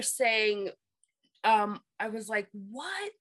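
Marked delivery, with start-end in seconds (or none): none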